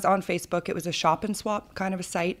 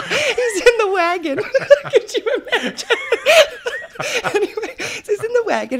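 real laughter breaking through the words, much higher and louder; giggling at her own mix-up, the words squeezed out between laughs; laughing; 8 dB louder than usual. Real, not acted. Laughing at own slip of the tongue.

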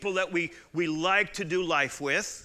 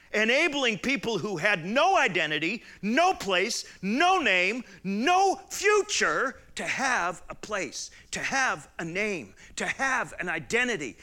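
higher, louder preaching climax; preaching at full force; 6 semitones higher, 4 dB louder than usual. Acted. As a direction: build the sermon to a ringing peak. Preaching builds to a peak.

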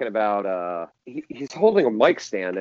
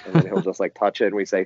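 usual speaking voice

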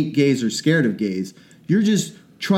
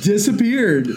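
mock listener voice; 8 semitones higher than usual. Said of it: "people are like".

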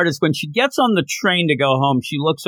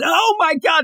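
excited shout; 13 semitones higher than usual. Real, not acted. Excited retelling of chase scene.

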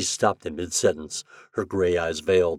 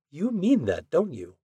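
wondering; 10 semitones higher than usual. A narrator's reading. She realizes he's serious.

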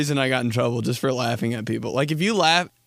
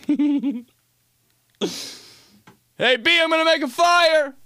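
high, excited holler as dad; imitated dad calling out; 14 semitones higher than usual. Dad announcing a fire to kid, imitated high holler. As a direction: holler it proudly across the house.

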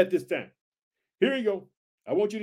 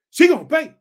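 high, loud, stern shout as God; 8 semitones higher, 8 dB louder than usual. Acted. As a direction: thunder the verdict. Preacher voicing God, angry.

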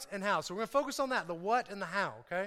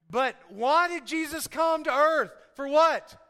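raised, emphatic pulpit voice; preaching; 5 semitones higher, 7 dB louder, slower than usual. Real, not acted. Preacher asks the congregation why Jesus came, emphatic preaching. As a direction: call it out to the whole room.

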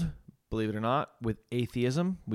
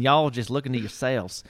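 put-on Southern accent; 2 semitones higher, 3 dB louder than usual. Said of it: "It was almost Southern".

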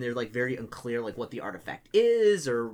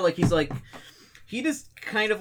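ordinary talk, nothing marked

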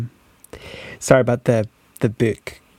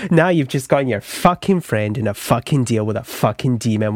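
ordinary talk

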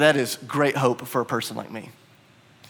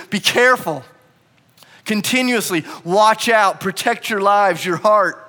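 raised, emphatic, drawn-out command; forceful warning; 6 semitones higher, 5 dB louder, slower than usual. Acted. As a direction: urge it on them like a warning. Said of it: "That's the tone, that's the, the feeling".

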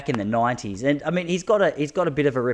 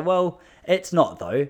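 slow mock rebuttal; 7 semitones higher, 4 dB louder, much slower than usual. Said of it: "I go".

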